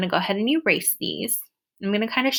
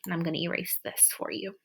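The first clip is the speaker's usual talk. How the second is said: normal talking voice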